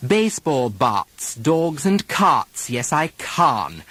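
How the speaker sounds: bats, carts and can with a mock ah, slow; mock the overdone British ah; wrong overdone broad A. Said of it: "all three of those pronunciations were wrong".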